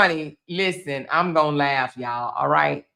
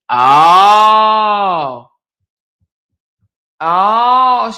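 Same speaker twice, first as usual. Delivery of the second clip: loud surprised oh; 3 semitones higher, 8 dB louder than usual. Real, not acted. Learns policy was three months.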